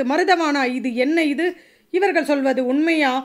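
plain reading voice

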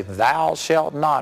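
very slow, drawn-out prohibition; solemn commandment. Acted. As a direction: pronounce it word by word like divine law. God pronouncing the commandment.

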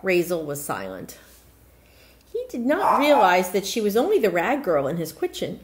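ordinary delivery

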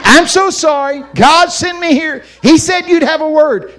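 loud, high wailing retelling; imitated tearful plea at high pitch; mock crying. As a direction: bawl each phrase out loudly. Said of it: "here's what he's crying".

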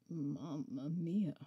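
slow, low mumbled mamma mia; deep creature mumble. A narrator's reading. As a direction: drag it out like a weary beast. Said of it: "it mumbled".

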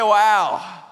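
a loud, punched Wow; leading the congregation in a loud Wow; cue the shout. Shown as a demonstration. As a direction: call it out for the crowd to echo. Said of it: "Somebody say".

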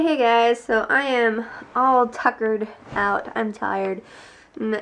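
ordinary talk

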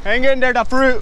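loud high call across the yard; calling from far away. Real, not acted. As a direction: shout it out to someone far off. Yelling at dog across distance.

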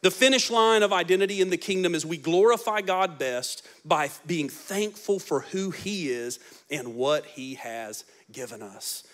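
normal talking voice

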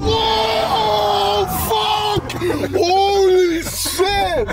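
very high, drawn-out shout; shocked shout. Real, not acted. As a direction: yell in alarm as the car scrapes. Car scraping on offroad path.

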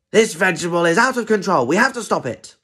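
high, urgent outcry; alarmed. Acted. Animals facing a vegetable out of control, alarmed outcry. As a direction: raise the alarm, pitched well up.

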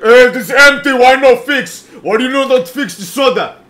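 put-on high, very loud Egyptian-accented rant; yelling, Egyptian accent. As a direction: imitate an Egyptian customer yelling at the cashier. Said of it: "is just yelling at P".

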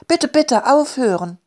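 put-on high, pleading child's call; crying out. A narrator's reading. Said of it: "rief Elli". (flehend gerufen, mit hoher Stimme)

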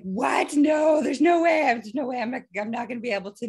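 loud, high disbelief, acted out; alarmed protest. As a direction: protest in mock panic. Retold protest at the announcement.

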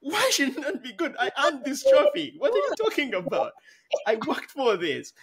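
high-pitched mock outrage; indignant retort. Imitating a migrant defending her hard-won English, high and indignant. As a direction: fire back, offended and proud. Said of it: "It's like".

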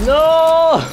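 a sudden yell of despair; anguished shout. Real, not acted. Gamer seeing his teammate fall, yelling.

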